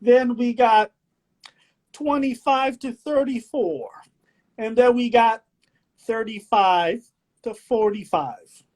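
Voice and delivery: slow, showy reading of quiz options; game-show host. Acted. Host reads out the choices, slow game-show delivery.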